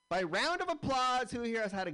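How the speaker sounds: high, loud call to the crowd; rally the room for applause. Acted. Comic asking the crowd for applause, loud high call.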